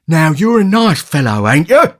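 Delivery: Mr Sowerby. A narrator's reading. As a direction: scold the boy sarcastically.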